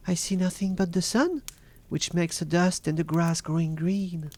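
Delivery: high-pitched voice of the watching sister; Sister Anne. A narrator's reading. Sister Anne reporting from the tower, high-voiced reply. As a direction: answer from above in a high voice.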